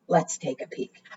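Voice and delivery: hushed, secret whisper. Acted. Librarian peeks under the flap for children, whispering. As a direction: breathe it so the mouse won't hear. Peeking into the paper house.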